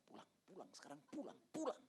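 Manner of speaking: soft, insistent whisper to leave; urgent hush. Retold or imitated. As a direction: hurry her out quietly. Tugging wife away quietly. (bisikan mendesak)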